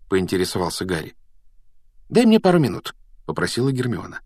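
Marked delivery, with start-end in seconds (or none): 2.1-2.9 high, lively request; eager Hermione. A narrator's reading. Hermione asks Harry for minutes, eager. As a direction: pitch it up, brisk and confident.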